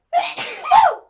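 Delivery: loud sudden sneeze; a real explosive sneeze; big sneeze. Real, not acted. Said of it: "That was a big sneeze".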